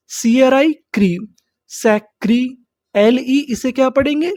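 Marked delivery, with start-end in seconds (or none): none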